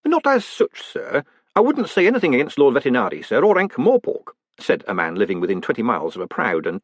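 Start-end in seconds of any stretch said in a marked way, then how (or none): 0.0-4.3 high, hedging small-town mayor; cautious mayor. A narrator's reading. Mayor avoids criticising the proud city, cautiously. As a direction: tread carefully, voice lifted in deference.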